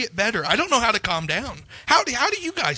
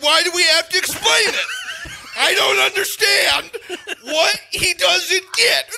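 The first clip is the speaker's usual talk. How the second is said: higher, louder, half-laughing rant; loud, higher mock outburst; exasperated outburst; 11 semitones higher, 3 dB louder than usual. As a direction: blow up in disbelief, laughing at yourself. Heated argument retold, laughing.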